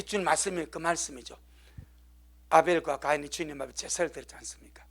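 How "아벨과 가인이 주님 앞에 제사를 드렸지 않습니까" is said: fast, low, voiceless; hurried whispered aside. Real, not acted. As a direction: rush it in a whisper. Preacher whispers.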